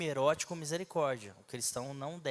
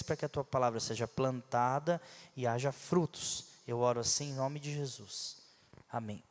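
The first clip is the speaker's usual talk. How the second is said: prayer; 5 semitones lower than usual. Acted. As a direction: pray humbly to the Lord. Prayer before the sermon. (oração serena e mais grave)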